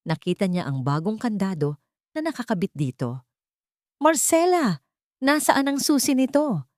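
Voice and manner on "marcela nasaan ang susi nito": shouting. Acted. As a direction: yell for her across the house. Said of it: "Sigaw niya".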